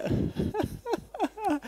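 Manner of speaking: a quick high giggle; laugh. Real, not acted. Laughing at her own joke about being alone.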